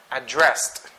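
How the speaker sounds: "Addressed" with a strong DRESSED; quick light start, land on DRESSED in "Addressed"; verb stress. Shown as a demonstration. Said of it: "it should be addressed".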